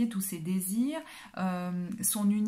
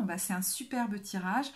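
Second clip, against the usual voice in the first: normal talking voice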